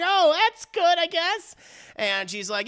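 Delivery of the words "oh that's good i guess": unsure relief. Retold or imitated. Friends unsure after news.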